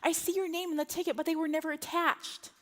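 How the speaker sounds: mimicking a sweet, unhelpful agent; airline agent. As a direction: apologize in a customer-service lilt. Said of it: "she's doing her little thing".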